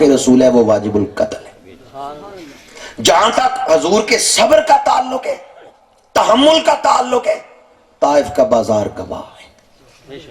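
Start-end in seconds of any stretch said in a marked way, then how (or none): 3.0-7.3 very high, sustained oratorical pitch; high-pitched rhetorical build-up; impassioned preaching. Real, not acted. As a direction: send each phrase up high like a preacher at the peak.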